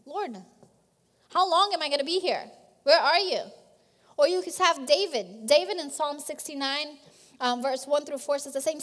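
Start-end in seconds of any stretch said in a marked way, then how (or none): 0.0-3.4 louder, fast plea to God; pleading prayer pushed out quickly and loud; blunt pleading prayer. Pretend. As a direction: cry out impatiently, where are you. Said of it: "that's pretty blunt".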